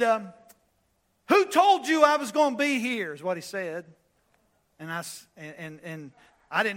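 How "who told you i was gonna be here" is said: loud, high, demanding call-out; loud challenge. Retold or imitated. Angry man shouting at the preacher mid-service, loud. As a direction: call it out across the church at full voice. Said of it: "He stood up and he said this".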